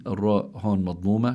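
ordinary delivery

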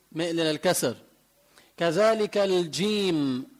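ordinary talk, nothing marked

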